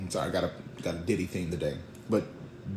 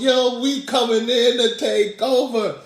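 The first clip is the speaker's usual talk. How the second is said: high, loud, slow proclamation; triumphant boast; 11 semitones higher, 8 dB louder than usual. Acted. Disciples entering town as champions, loud triumphant crowing. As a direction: announce the takeover in a drawn-out high holler.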